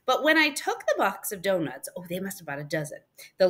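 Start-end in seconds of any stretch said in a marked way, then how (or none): none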